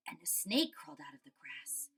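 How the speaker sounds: whispered narration; spooky whisper. A narrator's reading. Teacher setting up the snake, whispered. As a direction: hush it like a secret.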